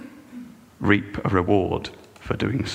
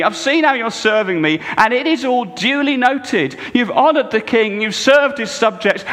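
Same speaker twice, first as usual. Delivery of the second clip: impassioned, louder, higher preaching; 12 semitones higher, 6 dB louder than usual. Acted. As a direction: drive it home from the pulpit. Preaching Jesus's words.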